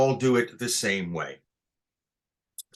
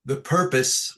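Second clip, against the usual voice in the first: ordinary talk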